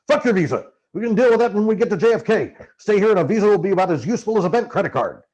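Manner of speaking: Hunter's voice, scornful. Acted. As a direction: wave the problem away as Hunter. Hunter dismissing the visa.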